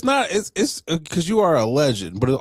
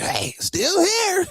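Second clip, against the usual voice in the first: high, slow, drawn-out "still here"; mock viral clip voice; much slower than usual. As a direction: stretch it out like the meme video. Mimics the viral video clip.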